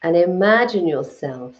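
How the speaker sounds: calm, unhurried meditation guide with long pauses; guided meditation. Acted. Guiding live viewers through a Reiki meditation, calm and unhurried. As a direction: lead the listeners gently into their breath and body.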